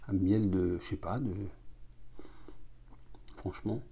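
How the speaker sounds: glass against lips; speaking between sips; talking while sipping drink. Real, not acted. Seen 1: Glass held at lips throughout labelled words, sipping while talking.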